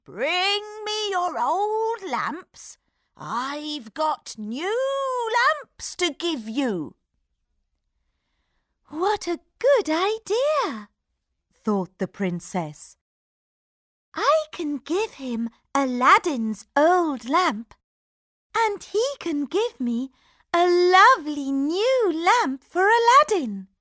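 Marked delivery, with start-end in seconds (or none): 0.0-7.0 put-on high, slow crying old peddler; wheedling old street seller; old man voice. A narrator's reading. Old man hawker voice continues. 8.9-10.9 high, bright-eyed princess voice; light girlish delight; cartoon princess. A narrator's reading. 14.1-23.7 high eager princess voice; sweet, pleased young princess; cartoon princess. A narrator's reading.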